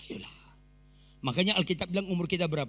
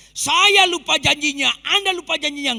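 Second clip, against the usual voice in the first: full-force preaching; 10 semitones higher, 12 dB louder than usual. Acted. Preacher shouts to congregation.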